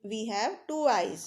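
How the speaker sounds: very slow, each word spaced; slow teacher dictation; slow example. Shown as a demonstration. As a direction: say it slowly for children to follow. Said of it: "जैसे".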